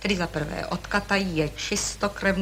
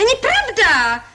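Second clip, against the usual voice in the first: high, loud, slow furious NEPRAVDA; screaming contradiction; shouted denial; 11 semitones higher, 6 dB louder than usual. Acted. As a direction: yell "Není pravda!" high and loud, slowing on each word. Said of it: "Proč křičíte".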